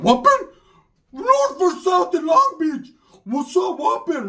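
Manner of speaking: high, loud slow challenge; hollering a North-side challenge; gang callout. Retold or imitated. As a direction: call it out across the street. Cousin calling out gang challenge.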